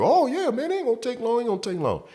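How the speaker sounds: high falsetto whiny coworker voice; mocking coworker. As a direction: squeak it out like the nagging buddy. Coworker brushing off the worry.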